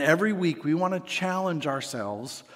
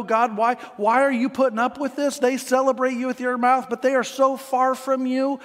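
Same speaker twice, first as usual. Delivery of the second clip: higher and louder, exasperated plea to God; irritated complaint; 6 semitones higher, 4 dB louder than usual. Retold or imitated. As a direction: let the prophet's irritation push up. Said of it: "He's irritated".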